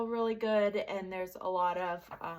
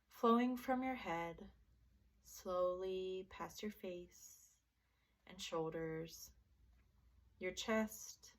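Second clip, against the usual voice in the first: guided meditation; 4 dB quieter than usual. Acted. Teacher leading listeners through a body relaxation, soft guided meditation. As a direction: gently guide them into relaxation, leaving space between phrases.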